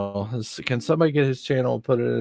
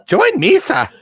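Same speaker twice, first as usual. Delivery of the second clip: high, loud comic villain; Jar Jar-style Darth Vader; 6 dB louder than usual. Acted. Vader promising rule to his son, high goofy alien. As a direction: proclaim it in a squeaky alien voice. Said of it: "talked like that".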